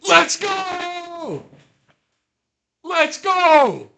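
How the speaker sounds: very high, loud victory yell; jubilant shout. Real, not acted. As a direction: scream it out as the win lands. Celebrating a sudden game win.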